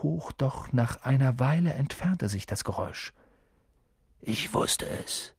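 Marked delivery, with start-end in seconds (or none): 4.2-5.3 hushed, slow mutter; murmeln. A narrator's reading. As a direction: mutter it low under the breath, drawn out. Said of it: "murmelte er". (leise gemurmelt)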